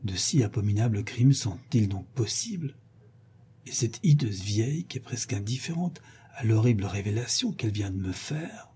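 hushed, pained disbelief; stunned murmur. Acted. Rodolphe, unheard by the portress, murmuring in painful stupor. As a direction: murmur it half-voiced, as if to yourself. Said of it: "murmura-t-il à demi-voix dans une stupeur douloureuse".

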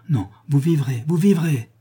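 rising desperate insistence; pleading cry. Acted. Prince begs dying man live.

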